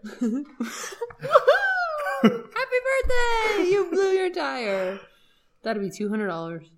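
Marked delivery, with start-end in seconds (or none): 0.1-5.0 high, slow, laughing cheer; mock celebration. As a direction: cheer it like a party surprise.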